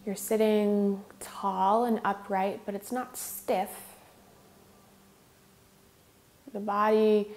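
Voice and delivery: measured meditation lead with long pauses; guided meditation. Real, not acted. Yoga teacher leading students in regulating the breath, guided meditation. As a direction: guide them unhurriedly, leaving space between phrases.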